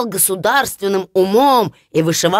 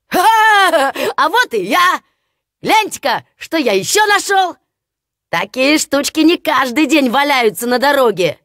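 gleeful shout; 5 semitones higher than usual. Acted. Hans shouting to his brothers about the clog, gleeful. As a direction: crow over your find like a proud fool.

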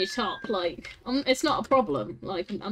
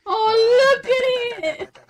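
excited squeal; 9 semitones higher, 5 dB louder, much slower than usual. Real, not acted. Excited at cute fox.

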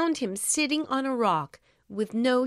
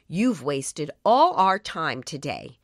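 angry scolding; 6 semitones lower, 4 dB louder than usual. A narrator's reading. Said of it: "said the villagers angrily".

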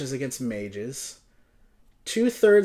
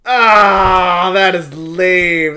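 frustrated groan; 2 semitones higher, 11 dB louder, much slower than usual. Real, not acted. Complaining about loss.

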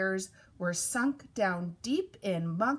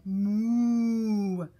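long, drawn-out moo; cow moo; 4 dB louder than usual. A narrator's reading. Cow greets the truck, long moo. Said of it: "Cow said".

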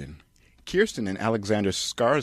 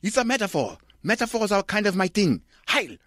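high, pompous mock-German director voice; smug art-house auteur declaring; Lars von Trier; 12 semitones higher than usual. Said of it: "Director Lars von Trier".

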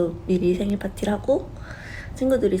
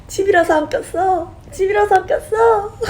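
much higher, whining, near fake crying; whiny excuse; 12 semitones higher, 4 dB louder than usual. Answering her friend about the ring, whiny and high. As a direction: plead your excuse like a sulky kid.

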